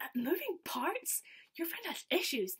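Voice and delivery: high, loud, drawn-out disbelief; mock incredulous. Performer reacting to a fan's crying friend, mock incredulous. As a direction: imitate a scandalised performer, slow and high.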